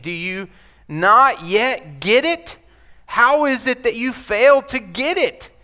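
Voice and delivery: Jesus to puzzled disciples, exasperated; exasperated question. Acted. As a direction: push the question with rising frustration. Paraphrasing Jesus, frustrated.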